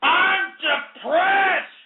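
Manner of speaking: drawn-out high shout of despair; sudden shout. Acted. Ranting host to his listeners, a long high shout. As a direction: bellow it slowly like a breaking man.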